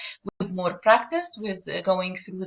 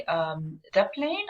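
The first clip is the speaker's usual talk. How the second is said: usual speaking voice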